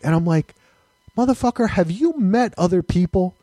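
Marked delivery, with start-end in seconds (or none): 1.2-3.3 raised pitch, incredulous comeback; mock-indignant retort to a doubter; exasperated retort. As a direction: argue back in higher-pitched disbelief.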